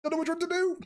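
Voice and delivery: playful high mock voice; mock squeaky dithering. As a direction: squeak out the indecision. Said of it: "I'm like".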